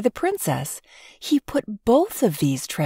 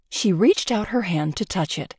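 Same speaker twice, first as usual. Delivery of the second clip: ordinary delivery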